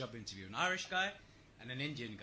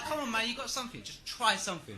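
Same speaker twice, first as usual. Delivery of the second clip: fast, pushy urging voice; pushy interviewer; 10 semitones higher, 6 dB louder, faster than usual. Acted. Joke interviewer urging nervous candidate, fast and pushy. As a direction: rush him to try something.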